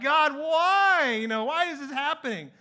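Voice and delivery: loud, high plea to heaven, fading at the end; exasperated cry. Retold or imitated. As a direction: throw the question at the sky in despair. Crying out after the breakdown.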